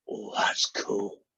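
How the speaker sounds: slow hushed whisper of amazement; awed whisper. Acted. The little boy whispers to the pirate in awe. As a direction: breathe it out, slowly, wide-eyed.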